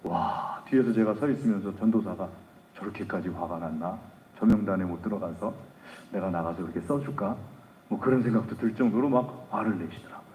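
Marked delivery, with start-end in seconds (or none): 2.8-7.4 much softer, lower musing to oneself; young preacher musing quietly in the back; muttered inner thought. Retold or imitated. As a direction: think it aloud under your breath.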